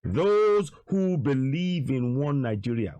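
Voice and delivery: slow, deliberate repeat; drawn-out, insistent restatement; said again slowly. Shown as a demonstration. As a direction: spell it out word by word. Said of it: "Let me say that again slowly".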